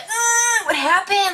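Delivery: high-pitched panicked cry, retold; freaking out. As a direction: act out alarm with a long high wail. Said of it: "I was freaking out".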